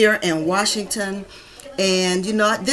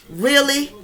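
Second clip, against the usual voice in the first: moderately raised incredulous question; reproachful, less explosive challenge; contained disbelief; 5 semitones higher than usual. Real, not acted. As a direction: press the point with restrained indignation. Repeats the question, more contained.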